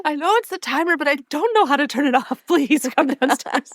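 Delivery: put-on high, helpless old-lady whine; copying the grandmother begging someone to come downstairs; panicky grandma. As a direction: plead high and flustered, breaking into a laugh. Friend repeats the Emily bit.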